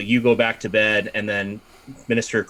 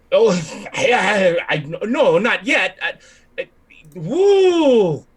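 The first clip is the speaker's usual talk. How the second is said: high, loud incoherent spluttering; flustered babbling; 8 semitones higher, 5 dB louder, slower than usual. Acted. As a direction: stammer helplessly and burst into a whoop. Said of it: "He's just babbling incoherently".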